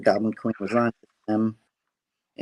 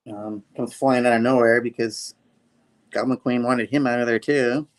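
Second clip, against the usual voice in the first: ordinary delivery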